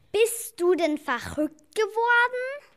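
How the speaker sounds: shocked at the idea; alarmed high protest. Acted. Angst vor Entdeckung. (erschrocken und viel höher)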